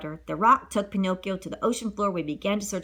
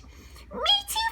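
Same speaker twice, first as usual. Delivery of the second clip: put-on high puppet boy. A narrator's reading. Pinocchio to Geppetto.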